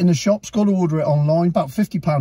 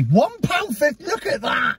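high, amazed TV valuer; excited appraiser; 9 semitones higher than usual. Pretend. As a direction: marvel at the price like an antiques expert. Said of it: "I'll do Antiques Roadshow on it".

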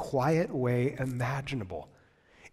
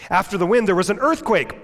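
booming reading; 7 semitones higher, 8 dB louder than usual. Acted. Preacher reads storm verses loudly.

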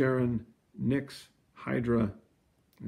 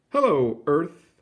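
higher, louder little planet voice; tiny world calling; 5 semitones higher, 6 dB louder, slower than usual. A narrator's reading. Tiny world greeting distant Earth, higher and louder. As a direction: call out brightly to faraway Earth. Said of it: "said the tiny world".

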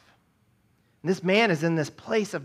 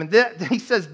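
usual speaking voice

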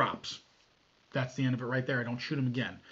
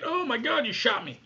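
much higher, whiny cry of the wounded guy; hammed-up shocked whine; mock shocked victim; 8 semitones higher than usual. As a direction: play the shot man in disbelief. Voicing the shot attacker.

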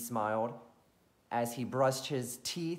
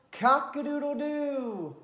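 rooster crowing; 12 semitones higher, 4 dB louder than usual. Acted. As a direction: crow it out again, easing off. Rooster call again.

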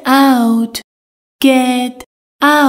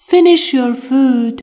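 slow model sentence; slower than usual. Shown as a demonstration. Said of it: "teacher repeats the model sentence".

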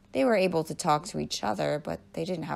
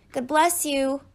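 high, slightly louder story voice; chirpy blessing; 9 semitones higher, 5 dB louder, slower than usual. A narrator's reading. Nancy to a sneezing classmate, chirpy blessing. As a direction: pipe it up like a kindly classmate.

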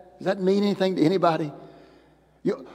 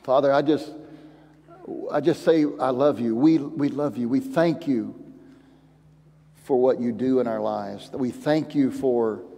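closing prayer; 3 semitones lower than usual. Acted. Closing prayer over music.

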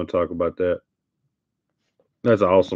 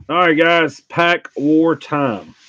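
announcer hype; 6 semitones higher, slower than usual. Real, not acted. Announcing the game to viewers.

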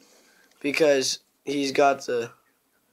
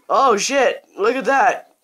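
real excited exclamation, higher and louder; excited outburst; 7 semitones higher, 4 dB louder, slower than usual. Real, not acted. Gamer reacting to his own gameplay, suddenly excited. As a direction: burst out high and loud.

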